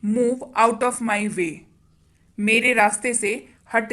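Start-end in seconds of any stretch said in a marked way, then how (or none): none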